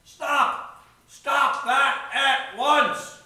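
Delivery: loud, high, drawn-out command; booming halt from the doorway; shouted order. A narrator's reading. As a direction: shout each word slowly to halt everyone. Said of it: "someone at the door shouted".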